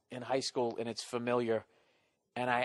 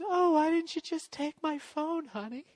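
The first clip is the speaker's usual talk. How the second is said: much higher mock wife voice, sing-song; wife casually asking why he skipped her phone; imitating wife. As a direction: chirp it like a cheerful wife who missed the point. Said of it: "And she just goes".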